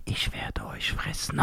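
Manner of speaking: breathy, slow ghostly whisper; a slow, spooky whisper from the dark; creepy whisper. Retold or imitated. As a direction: whisper the threat like a hidden ghost. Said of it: "Und dann diese Stimme".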